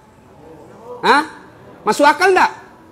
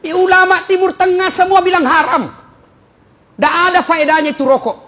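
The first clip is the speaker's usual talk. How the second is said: forceful preaching; 8 semitones higher, 6 dB louder than usual. Real, not acted. Preacher denouncing smoking.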